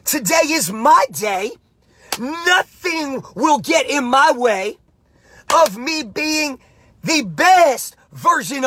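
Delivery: slow, emphatic declaration with stretched key words; passionate, intense mantra. Shown as a demonstration. Coach demonstrating the win all day mantra for repeating, passionate and intense. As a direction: declare it with full intensity, pausing between phrases. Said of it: "I say it with passion. I say it with enthusiasm. I say it with intensity".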